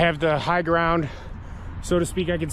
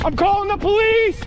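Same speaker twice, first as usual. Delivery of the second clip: shouting; 5 dB louder than usual. Real, not acted. Yelling at pursuers.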